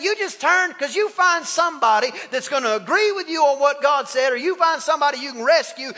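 normal talking voice

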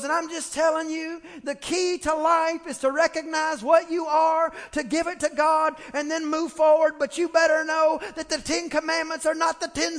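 nasally pastor voice. Retold or imitated. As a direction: lay down the law like a nasal pastor. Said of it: "he kinda had this nasally talk like this".

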